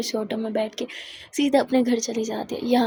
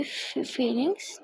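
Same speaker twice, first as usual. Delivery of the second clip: shy stammer; 6 dB quieter, much slower than usual. Acted. Shy girl asked about feelings.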